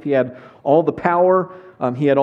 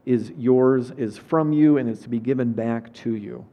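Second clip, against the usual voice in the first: soft, lower prayer voice; 5 semitones lower, 4 dB quieter than usual. Acted. Pastor praying over the congregation before the sermon, soft and lowered. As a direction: pray it gently before the reading.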